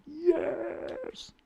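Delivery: high, drawn-out celebratory yes; a long high squeal of joy; joyful yes. Retold or imitated. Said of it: "I jumped for joy".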